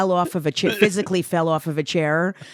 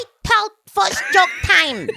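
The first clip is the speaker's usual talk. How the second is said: high, drawn-out mock performance; hammed-up comic bit; 12 semitones higher, slower than usual. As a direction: ham it up, pitched way high and slow. Acted bit amid mock SNL audition.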